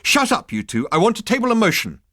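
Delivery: high, loud and bossy, rushing the motion; a strident committee girl cutting in. Acted. Girl shouting down two bickering members, strident. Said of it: "A girl with a strident voice".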